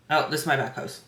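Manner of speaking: ordinary talk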